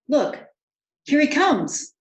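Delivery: high and loud, pointing it out; excited announcement. A narrator's reading. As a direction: call out as the king appears. Servant sees the king arriving.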